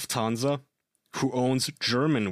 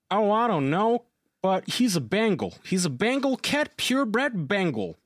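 lightly voiced stranger, pitched up; imitating cat owner; 8 semitones higher than usual. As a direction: retell the cat owner's answer in a higher voice. Quoting the cat smuggler's answer.

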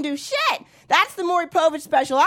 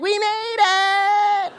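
high, very slow triumphant drawl; high slow gloating arrival; 5 semitones higher, much slower than usual. Retold or imitated. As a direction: savor the victory. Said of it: "they go to heaven like".

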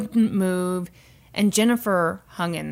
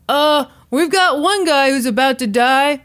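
raised, loud on-air voice; radio DJ sidekick; 6 semitones higher, 6 dB louder than usual. Retold or imitated. Sidekick answering the DJ's question, loud raised radio voice. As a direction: imitate a sidekick reporting back on air.

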